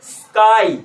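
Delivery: "स्काई" as a long hiss, then "kai"; breathed-out s. Shown as a demonstration. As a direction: stretch the s of "स्काई" slowly. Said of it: "सांस बाहर निकाला फिर काई". ("स्काई" में लंबा स, फिर काई)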